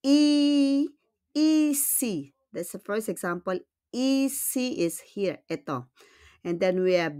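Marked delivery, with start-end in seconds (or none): 0.1-2.3 "E" as a long, high "ee"; "E C" as a drawn-out "ee-see"; French i sound. Shown as a demonstration. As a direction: hold the "ee" in "E C", higher pitched. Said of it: "how do we pronounce it".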